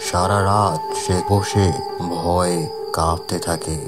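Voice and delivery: usual speaking voice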